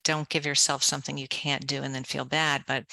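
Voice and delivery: ordinary talk, nothing marked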